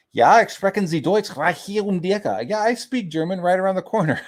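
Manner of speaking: high-pitched hammed-up German accent; mock German. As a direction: play a silly German speaker, pitched high. Mock German, then translation.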